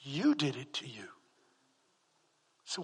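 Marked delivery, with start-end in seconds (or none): 0.0-1.1 whispered, almost no voice; hushed whisper. Retold or imitated. Pastor quoting God to a dying man, whispered. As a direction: breathe it as God's quiet word.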